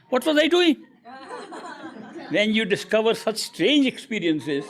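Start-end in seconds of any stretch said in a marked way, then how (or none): 0.1-0.8 a much higher, louder, stunned "What was I doing?"; astonished realisation. Retold or imitated. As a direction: cry out at the shock of discovery. Shocked discovery of past lives.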